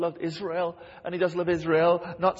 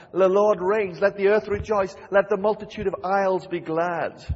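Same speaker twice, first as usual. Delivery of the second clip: louder, ringing psalm reading; the preacher calls out the psalm, louder and lifted; proclaimed scripture; 3 semitones higher, 7 dB louder than usual. Acted. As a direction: proclaim it to the congregation.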